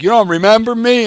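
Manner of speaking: mock-incredulous foreman demanding recognition; retold foreman. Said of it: "he goes like".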